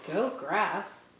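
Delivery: ordinary delivery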